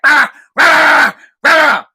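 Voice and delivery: loud, high bursts of yapping; imitated dog barking. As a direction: yap like a pesky little dog. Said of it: "he barks at the worst times".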